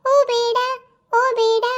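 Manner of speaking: scared cry of don't; frightened plea. Acted. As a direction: plead in a panic. Victim begs the thief.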